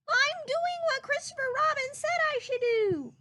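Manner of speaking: high, small squeaky voice calling from a tree; a tiny piglet shouting down bravely. Acted. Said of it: "Piglet called".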